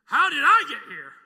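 high, loud, wide-eyed wonder; amazed question. Pretend. Preacher voicing his late brother's amazement in heaven, loud and high. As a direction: sound utterly astonished to wake in heaven.

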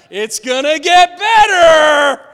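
over-the-top shouted "better"; mock cheerful shout. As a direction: shout it like a pushy optimist. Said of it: "Joyful Joe or Happy Hannah".

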